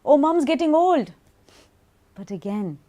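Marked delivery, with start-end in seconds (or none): 0.0-1.1 imitated teasing, higher and much louder; loud, high mock jibe; teasing mockery. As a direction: poke fun at mum, raised voice. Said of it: "They took the mick a bit".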